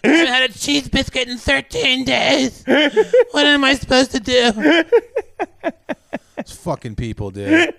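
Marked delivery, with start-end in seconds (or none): 0.0-4.5 slow high whine through laughter; whiny entitled voice. Pretend. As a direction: whine it, barely holding a laugh. Mocking anti-mask whiners.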